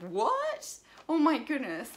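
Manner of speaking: astonished. Retold or imitated. Retelling her reaction to being called a model, astonished.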